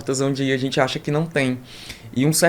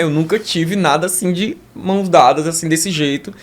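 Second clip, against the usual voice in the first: ordinary talk, nothing marked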